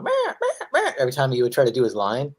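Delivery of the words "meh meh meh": high squawking meh; seagull cry. Retold or imitated. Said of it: "the seagull kept going".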